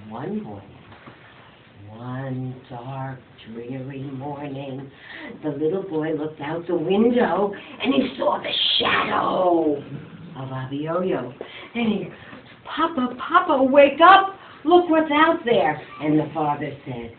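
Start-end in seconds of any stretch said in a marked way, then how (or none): 0.1-4.9 low, soft and slow; ominous hush. A narrator's reading. Storyteller sets a dark scene. 12.6-15.8 higher, louder calling; urgent boy. Acted. Boy wakes his father.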